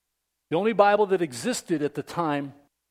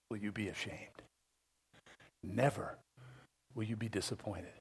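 much softer, near a whisper; hushed promise; 8 semitones lower, 7 dB quieter than usual. Real, not acted. Preacher softly assuring believers, almost whispering. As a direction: lean in and breathe the promise.

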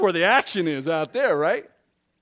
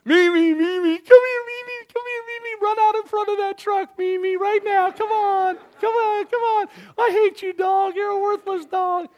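much higher, sing-song coaxing call with cruel words; sweet-talking the dog; 5 dB louder than usual. Retold or imitated. Owner calling the poodle Mimi in a cooing high voice. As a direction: coo at the dog like baby talk while insulting it. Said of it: "and we'd go".

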